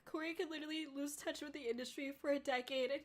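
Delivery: choked up, pitch climbing high at the end; tearful reading that gives way; trying not to cry. Real, not acted. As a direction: push through the sentence as tears take over. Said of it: "I'm really trying not to cry".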